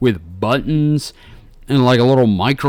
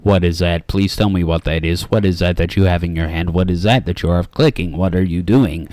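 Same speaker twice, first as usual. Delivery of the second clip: lower, flat stranger grilling her about a pen; lightly voiced odd interrogator, rapid questions; a hint of an eerie stranger fixated on a pen; 8 semitones lower, faster than usual.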